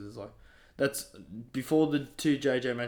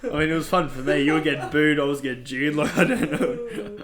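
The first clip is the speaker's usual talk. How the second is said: loud, high-pitched, laughing delivery; 5 semitones higher, 10 dB louder than usual. Real, not acted. Wrestler joking about getting jeered, laughing. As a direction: crack up as you explain, getting higher and louder.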